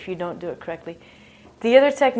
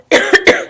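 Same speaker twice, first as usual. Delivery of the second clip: short, loud, forced cough; exaggerated demo cough; demonstrated cough; 11 semitones higher, 9 dB louder than usual. Shown as a demonstration. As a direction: show a cough right after naming it.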